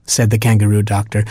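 ordinary delivery

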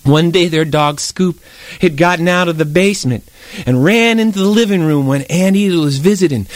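ordinary delivery, nothing marked

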